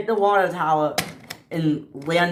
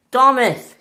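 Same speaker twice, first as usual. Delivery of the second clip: high, loud call to Thomas; shout; 8 semitones higher, 6 dB louder than usual. Acted. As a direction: call out for help, pitched up. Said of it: "he shouted".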